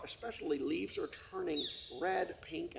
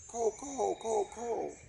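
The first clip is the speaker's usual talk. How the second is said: higher hooting ko ko of a little owl; owl call; 7 semitones higher, slower than usual. Acted. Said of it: "little owl that says".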